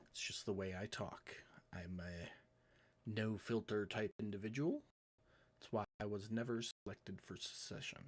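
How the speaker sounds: low, soft condolence; sincere apology. Acted. Royal apologises to grieving friends, low and soft. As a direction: speak gently and quietly, with careful pauses.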